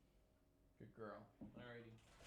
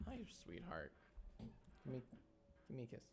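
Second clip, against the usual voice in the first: pet talk; 18 dB quieter, faster than usual. Real, not acted. Greeting the dog fondly.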